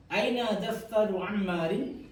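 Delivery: slow repeat with a rising end; slow model question. Shown as a demonstration. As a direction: say it again slowly for them. (ulangi pelan-pelan)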